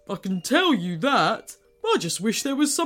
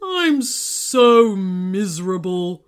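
miserable volcano; 6 dB louder, slower than usual. A narrator's reading. Sad volcano.

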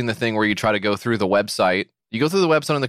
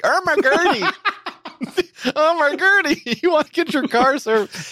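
put-on very high, slow, laughing old-man voice; Relic voice; slower than usual. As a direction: ham up Relic through giggles. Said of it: "He started talking like Relic".

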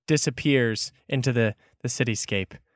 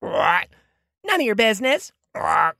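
high-pitched bird retort between squawks; snippy Kenku rebuff; 7 semitones higher than usual. Acted. Kenku refuses to give its name, high squawking retort. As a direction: squawk it back, refusing to answer.

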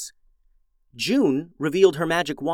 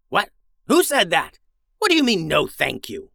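indignant villain, higher and louder in outrage; growled; 4 semitones higher, 4 dB louder than usual. Acted. Wizard rounds on the villager who refused him, outraged growl. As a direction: snarl back at the insolent villager. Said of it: "growled the wizard".

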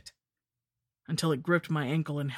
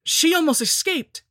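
high, loud frantic cry; loud desperate cry; 13 semitones higher, 8 dB louder than usual. A narrator's reading. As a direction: blurt it out in panic. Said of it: "cried Dudley".

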